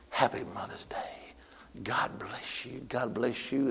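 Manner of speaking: very soft, low, almost whispered; gentle blessing. Retold or imitated. Man wishing a snippy mother well, gentle blessing. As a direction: drop right down and bless her quietly.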